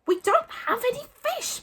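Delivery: high, strained exasperation; calm deserting, pitch shooting up; gritted teeth. A narrator's reading. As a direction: force it out through gritted teeth. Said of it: "from between gritted teeth".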